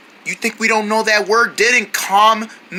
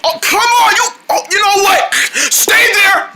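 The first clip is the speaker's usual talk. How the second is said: exasperated shout; 10 semitones higher than usual. Pretend. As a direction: yell after him in frustration. Chasing a runaway creature, calling out.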